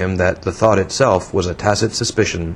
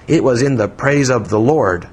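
usual speaking voice

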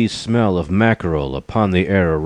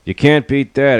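high, louder cat's exclamation; exclaiming; 7 semitones higher, 5 dB louder than usual. A narrator's reading. Cat remarking on the lion's gall, high exclamation. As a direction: exclaim it in a raised voice. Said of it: "exclaimed the cat".

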